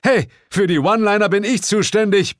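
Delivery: high, loud indignant shout; Bill bellows over the fight, claiming the one-liners; Bill yelling. Acted. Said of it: "brüllte Bill".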